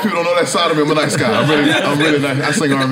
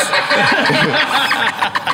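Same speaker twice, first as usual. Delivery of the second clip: laughter; 8 semitones higher than usual. Real, not acted. Laughs mid story.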